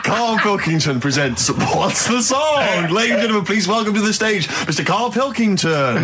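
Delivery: big, slow showbiz host introduction; game show announcer. Pretend. Presenting Carl's new quiz show to the audience, hammed-up announcer voice. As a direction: boom it out like a TV host bringing on the star.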